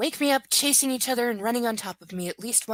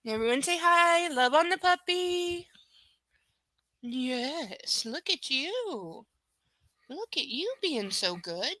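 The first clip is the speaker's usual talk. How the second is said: puppy praise talk; 7 semitones higher, slower than usual. Real, not acted. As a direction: gush over the good puppy.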